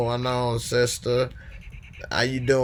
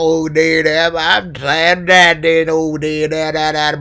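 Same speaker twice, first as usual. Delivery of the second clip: Mississippi accent impression; 6 semitones higher, 9 dB louder than usual. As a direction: ham up a loud Mississippi voice. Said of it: "my Mississippi accent might throw y'all off".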